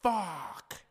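sudden loud high curse, imitating the husband; angry curse. Husband missing the shuttle, blurting a loud frustrated curse. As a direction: spit out the swear like a man who just missed his ride. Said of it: "He's like".

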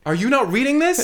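excited indignant yell. Real, not acted. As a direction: demand they read the shirt. Showing off a joke shirt.

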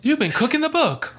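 high-pitched comic accusation; mock accusation. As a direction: tease in a squeaky-high voice. Teasing a joke about taxes.

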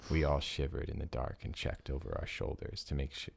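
ordinary delivery

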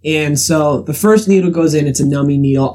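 ordinary delivery

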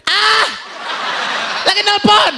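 mother's held, sky-high phone voice; very high-pitched. Retold or imitated. Mother telling her child she is on the phone, very high-pitched. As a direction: call it out on one high note. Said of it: "selalu nadanya lima setengah oktav".

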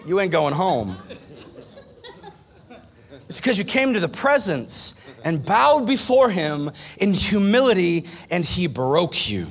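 ordinary delivery

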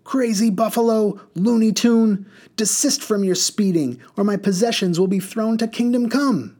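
high, loud angry tirade; furious scolding. Acted. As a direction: berate the reckless driver. Woman scolding driver.

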